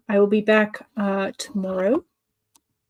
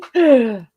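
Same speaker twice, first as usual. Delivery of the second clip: mock gasp; 3 semitones higher, 7 dB louder than usual. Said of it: "Shocked face".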